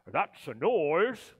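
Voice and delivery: lightly voiced Grinch, smug and pleased; a hint of Grinch savoring their misery; gloating Grinch. The Grinch pictures the Whos waking up, gloating. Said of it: "grinned the Grinch".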